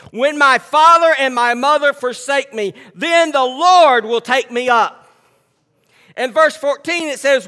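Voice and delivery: loud, high, slow preaching. Acted. Pastor preaching scripture to the church, full force. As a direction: preach it out to the congregation.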